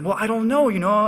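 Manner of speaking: mock-nervous stammer. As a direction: act flustered and caught out. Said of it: "I started getting all very nervous".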